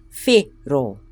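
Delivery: whero said slowly as FEH-ro with tapped R; whole word slowly. Shown as a demonstration. Teacher joins both syllables slowly for learners. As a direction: put the syllables together slowly. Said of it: "So put together".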